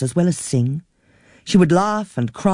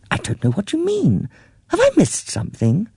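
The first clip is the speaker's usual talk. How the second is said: higher, gently explaining; a lightly voiced patient middle-aged niece; 7 semitones higher than usual. A narrator's reading. Niece reminds forgetful aunt who Christopher is, patiently. Jean puzzled.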